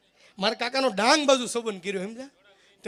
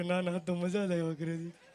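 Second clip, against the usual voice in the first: chuckling uncle; 4 semitones lower, 6 dB quieter than usual. Retold or imitated. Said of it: "કે".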